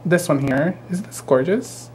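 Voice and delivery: ordinary talk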